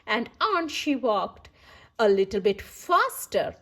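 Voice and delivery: usual speaking voice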